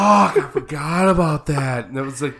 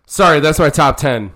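much louder, punched-up retake; loud mock apology; 2 semitones higher, 11 dB louder than usual. Redoing the spoiler apology for the edit, loud and emphatic. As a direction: blurt it loudly over the others.

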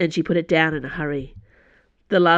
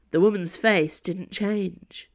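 ordinary delivery

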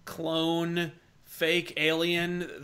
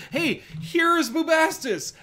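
slow mock announcement; 11 semitones higher, 3 dB louder, slower than usual. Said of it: "You're like".

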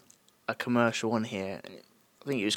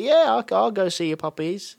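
higher and louder, eagerly gullible; naive boy; 9 semitones higher, 7 dB louder than usual. Retold or imitated. Voicing the cartoon boy agreeing to go with the stranger, eager and high. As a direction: chirp it like a trusting kid. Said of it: "and he's like".

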